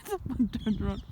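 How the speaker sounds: normal talking voice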